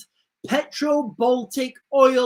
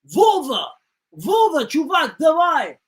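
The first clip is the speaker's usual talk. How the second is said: higher, louder hammed-up sailor yell; mock Russian shout; 5 semitones higher, 4 dB louder than usual. Sailor yells to Vova, hammed-up Russian shout. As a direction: bellow it down the chain of command.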